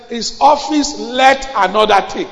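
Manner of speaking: slow, loud booming proclamation; scripture shouted. Acted. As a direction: thunder the verse out slowly. Preacher quotes scripture loudly.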